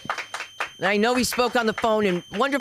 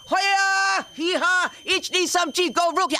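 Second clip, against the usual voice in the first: hammed-up Bruce Lee battle cries; kung fu yells; 12 semitones higher, slower than usual. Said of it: "I was ready for him".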